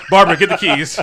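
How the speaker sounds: loud quoted order, half laughing; loud comic call-out; mock urgent call. Acted urgent call in retold story.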